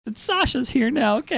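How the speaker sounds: high, sweet, reassuring tone; mock reassuring. Recapping the show, soothing the abandoned girl in a high voice. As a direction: coo it like a comforting friend.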